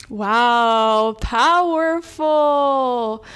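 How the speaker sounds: loud, drawn-out wow of real excitement; a long, loud, amazed "powerful"; awed exclamation. Real, not acted. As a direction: let it land big and slow, genuinely impressed. Reacting to her own empowering script.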